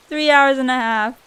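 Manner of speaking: ordinary talk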